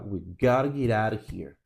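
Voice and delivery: "We gotta get out of here" run together, beats on GOT, OUT, HERE; three pulses, reduced. Shown as a demonstration. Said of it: "dão um pulso só nessas três".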